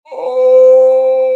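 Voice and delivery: long, loud, high "oh"; drawn-out dismayed wail. Real, not acted. Hears the gift was forgotten.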